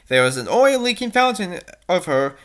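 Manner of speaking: ordinary delivery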